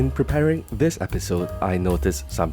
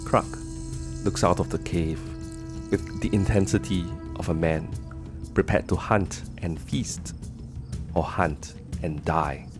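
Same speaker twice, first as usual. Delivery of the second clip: screenplay narration; 5 dB quieter than usual. A narrator's reading. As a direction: read it like a movie opening. Screenplay scene setting.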